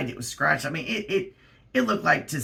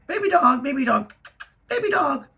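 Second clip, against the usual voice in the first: calling the dog; 11 semitones higher, 4 dB louder than usual. Retold or imitated. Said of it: "So I even tell her".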